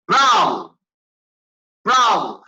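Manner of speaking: loud joyful bravo; king shouts with joy; happy shout. Acted. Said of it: "बादशाह चिल्लाया".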